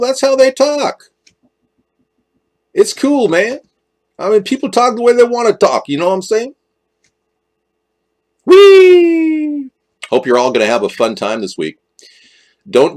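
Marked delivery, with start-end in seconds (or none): 0.0-6.5 put-on street voice. 8.4-9.7 gleeful squeal. Real, not acted.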